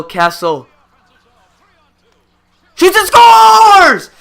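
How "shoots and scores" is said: huge, high, slow roar of a goal call; goal call shout. Real, not acted. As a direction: explode on the goal like the arena just erupted. Live goal call in play-by-play.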